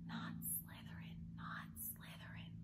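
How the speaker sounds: whispered, pleading not Slytherin; silent pleading thought. A narrator's reading. Harry silently begging the Sorting Hat, whispered. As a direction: breathe it as a desperate inner plea.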